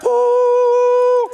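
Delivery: long, high, loud held "woo"; angel choir. Retold or imitated. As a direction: imitate angels singing on one sustained note. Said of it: "it's like angels started singing".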